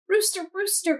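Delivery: high, loud call. A narrator's reading. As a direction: call across the yard. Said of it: "called Hen".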